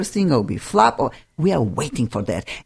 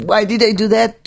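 higher-pitched, lightly whiny complaint; mock whine; 6 semitones higher, 3 dB louder than usual. Self-employed person regretting a decision, mock whining. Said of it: "you can whine".

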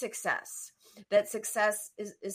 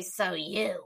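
mocking inner voice; 4 semitones lower than usual. Coach imitating client's self-talk, dropped low and mean. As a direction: drop the voice into a put-down. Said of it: "you use this voice and you say".

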